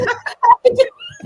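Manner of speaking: very high real laugh; squealing laugh. Real, not acted. Laughing at own story.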